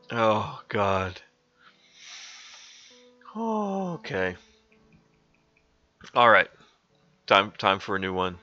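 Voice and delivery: plain reading voice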